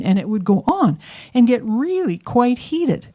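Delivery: plain reading voice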